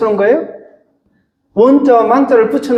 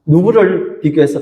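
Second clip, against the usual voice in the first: ordinary delivery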